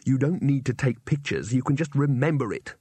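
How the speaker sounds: plain reading voice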